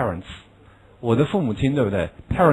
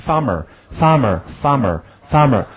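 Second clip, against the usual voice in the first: slow repeated model word; slow drill reading; much slower than usual. Shown as a demonstration. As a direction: repeat "farmer" slowly for echoing. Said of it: "而不要say".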